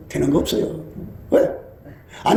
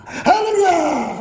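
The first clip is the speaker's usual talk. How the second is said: higher, long exultant hallelujah; drawn-out hallelujah; 8 semitones higher, much slower than usual. Retold or imitated. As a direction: stretch it out in joy. Prayer ends in praise. (길게 끌며 기뻐 외치는 할렐루야)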